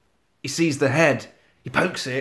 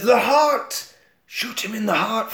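high-pitched mock urgency; urgent cry; 6 semitones higher than usual. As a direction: call it out as a sudden realisation. Mum urgently calls out remembered advice.

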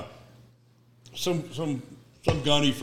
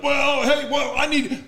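higher, louder flustered stammer; 1 flustered backpedal; 12 semitones higher, 5 dB louder, slower than usual. Retold or imitated. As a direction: squeak out an excuse. Gunny backing down on phone.

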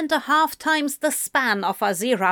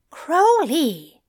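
warning; 7 semitones higher, 4 dB louder than usual. A narrator's reading. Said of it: "Zira warned".

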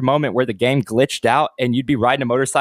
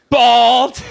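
loud, higher-pitched outburst; blurted callout; 7 semitones higher, 8 dB louder than usual. Retold or imitated. Friend retells her calling out a man's baldness, loud blurt.

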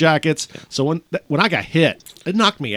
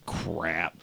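muttered dismay; 9 semitones lower, 12 dB quieter than usual. Retold or imitated. Realizing lip is gone.

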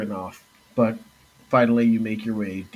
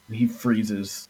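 ordinary talk

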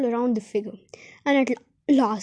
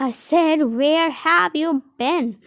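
louder, slow, repeating the demand; angry aunt; 4 semitones higher, 4 dB louder, much slower than usual. A narrator's reading. Aunt repeating her question to Medal, furious. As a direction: hammer out each word in fury. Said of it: "said his aunt angrily".

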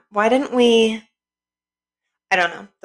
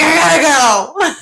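hurried shout; 9 semitones higher, 6 dB louder, slower than usual. Retold or imitated. Retelling a rushed phone call.

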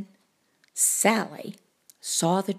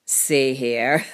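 low cartoon cat voice; deep storybook cat; confident cat; 10 semitones lower than usual. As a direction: start deep, about to chuckle.

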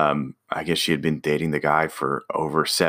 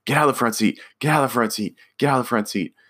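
mild mock yell, pitched up; higher-voiced, repeated retold demand; mock screaming; 6 semitones higher, much faster than usual. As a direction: imitate a screaming sister without really shouting. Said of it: "just screaming at her sister again".

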